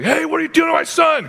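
very high-pitched hammed-up yell; mock yell. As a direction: call out in comic outrage at a stranger. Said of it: "I wanted to yell".

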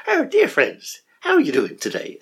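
high, bright-pitched Irish lilt imitating a friend; Irish accent. Imitating Irish meditation guide greeting listeners, Irish accent. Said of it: "I'll try and do an Irish accent".

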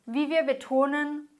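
normal talking voice

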